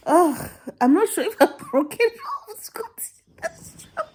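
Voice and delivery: high-pitched fake weeping; hammed-up tearful wail; mock sobbing. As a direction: whine it through pretend tears. Mock crying over quitting.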